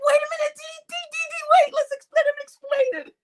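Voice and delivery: high, urgent wait wait; high frantic plea to wait; frantic pleading. Retold or imitated. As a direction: beg them to hold on, high and quick.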